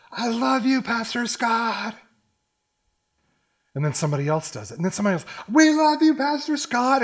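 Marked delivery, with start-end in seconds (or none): 0.1-2.0 high, drawn-out call; imitated congregant's shout. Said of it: "someone stands up and says". 5.5-7.0 high, loud cheering call; imitated crowd scream. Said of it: "they're all just screaming".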